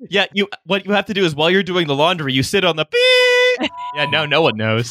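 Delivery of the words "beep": long, high held beep; censor bleep. Pretend. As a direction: imitate a bleep censoring the words. Imitating a censor bleep mid-sentence.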